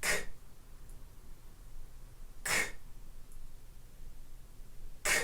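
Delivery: "K" as a quick voiceless k click, no vowel; k sound. Shown as a demonstration. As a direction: say "K" alone, a short puff. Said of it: "Let's give it a try".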